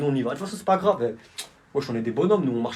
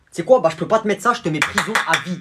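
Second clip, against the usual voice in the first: shouting; 4 semitones higher, 6 dB louder than usual. Acted. Judge yelling the sentence.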